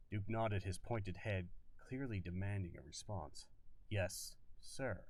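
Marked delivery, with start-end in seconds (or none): 3.9-5.0 "Yes, sir" with a clear gap between the words; words separated. Acted. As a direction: say "Yes" then pause, then "sir". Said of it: "separated the words clearly".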